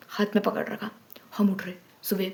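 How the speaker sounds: hushed suspense whisper. Real, not acted. Telling viewers about mom with the stick, whispering. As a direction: drop to a whisper as the tension builds.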